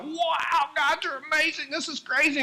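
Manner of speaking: high, wide-eyed wonder; amazed gushing to God. Retold or imitated. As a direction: gasp out amazed praise. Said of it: "I'm like".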